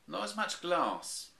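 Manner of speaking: high and slow, dry; deadpan high mother bear; Mummy Bear voice. A narrator's reading.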